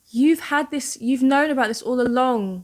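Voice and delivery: voicing a betrayed look; hurt accusation, acted out mildly; imagined betrayal. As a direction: put his sense of betrayal into words. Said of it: "expression was more still betrayal".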